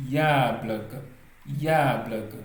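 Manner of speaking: YABLAKA with the first syllable punched loud and drawn out; stressed first syllable. Shown as a demonstration. Teacher showing learners the Russian stress, first syllable of Yablaka emphasized. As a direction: lean hard on the opening "ya" of Yablaka. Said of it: "Vous voyez comment j'insiste là-dessus".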